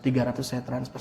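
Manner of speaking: ordinary talk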